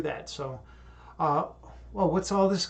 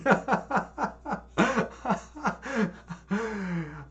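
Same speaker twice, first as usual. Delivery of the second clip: real laughter; 5 semitones higher than usual. Real, not acted. Laughing at odd chat quote.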